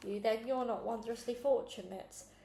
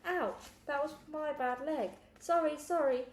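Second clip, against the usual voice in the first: mock pained; 6 semitones higher, 3 dB louder than usual. Alexander hurts his leg.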